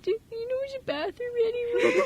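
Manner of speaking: high laughing imitation of a dim character; clueless Russell. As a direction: squeak it like a clueless guy. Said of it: "he's like".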